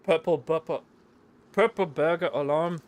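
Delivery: loud, high mock Scottish burr; a hammed-up Scottish accent, loud and slow. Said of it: "Say purple burger alarm in a Scottish accent".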